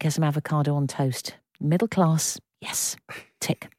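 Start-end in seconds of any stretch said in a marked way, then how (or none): none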